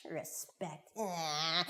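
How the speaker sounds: low, soft dismissive grumble; scornful muttering. Acted. Boy alone down the beach mocking the rule, muttered scorn. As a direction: mutter it with contempt under the breath.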